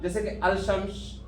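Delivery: ordinary talk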